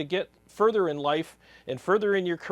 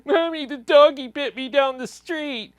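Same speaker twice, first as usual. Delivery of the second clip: put-on high, loud childish tattling; whiny little kid; 9 semitones higher, 7 dB louder than usual. Little Timmy tattles to his mommy, high whiny child voice. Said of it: "running up to his mommy and saying".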